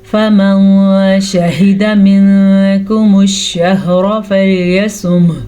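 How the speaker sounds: slow, measured tajweed chant; drawn-out Quranic recitation. Shown as a demonstration. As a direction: recite each syllable slowly and clearly.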